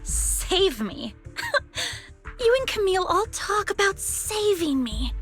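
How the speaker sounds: high, incredulous scoff after a short laugh; bitter scoffing. Acted. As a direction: laugh it off, then throw it back high-pitched. Scoffs at being saved.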